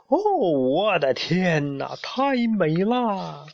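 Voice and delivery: high, very drawn-out wonder; entranced. A narrator's reading. Friend mesmerized by the green glow, entranced. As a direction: swoon over it, stretching every word. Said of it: "他越看越着迷".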